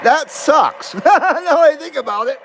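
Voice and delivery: wry laugh through the words. Real, not acted. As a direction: laugh at your own bad luck. Laughing over lost girlfriend.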